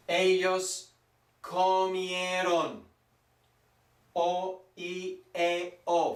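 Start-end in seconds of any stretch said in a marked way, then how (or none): 0.1-2.8 "comieron" drawn out, all four vowels heard; very slow, louder teacher demo of "comieron"; every vowel pronounced. Shown as a demonstration. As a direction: stretch "comieron" so o, i, e, o each sound. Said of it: "you must pronounce them all".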